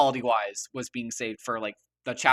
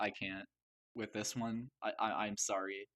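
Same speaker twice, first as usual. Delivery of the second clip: near tears; 8 dB quieter than usual. Real, not acted. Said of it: "I'm actually gonna cry".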